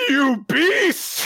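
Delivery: very high, loud mock accusation; outraged shout. Golfer accusing his rival after a beat, theatrical outrage. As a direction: cry it out melodramatically.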